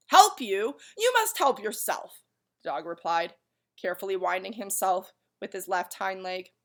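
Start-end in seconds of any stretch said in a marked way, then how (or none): 0.1-2.2 high, loud scolding; stern rebuke. Acted. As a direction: throw the plea back at him. Stern dog rebuffs Milo.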